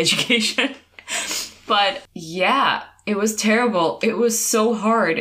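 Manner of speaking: normal talking voice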